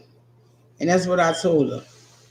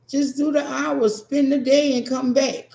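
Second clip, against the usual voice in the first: mock advice; 9 semitones higher than usual. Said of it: "everybody's like".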